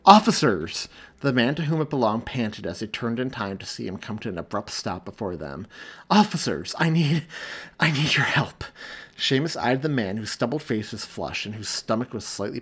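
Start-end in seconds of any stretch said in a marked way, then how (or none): 0.1-0.9 loud, higher panicked call for help; panicked shout. A narrator's reading. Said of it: "loud, panicked voice". 6.1-8.6 higher, louder breathless begging; panicked plea. A narrator's reading. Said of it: "panicked voice".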